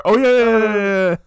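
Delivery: over-the-top excited agreement; excited yeah. Acted. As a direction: squeal the yeahs in mock delight.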